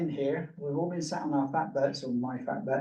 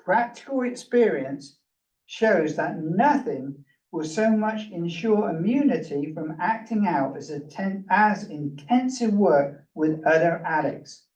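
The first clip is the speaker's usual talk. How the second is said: slow, measured reading from the book; a raised, deliberate recital; reading aloud; 4 semitones higher than usual. Acted. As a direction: read the passage with weight. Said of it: "the very first paragraph says".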